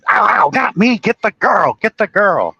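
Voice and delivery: movie villain quote. Retold or imitated. Retelling movie villain's cry.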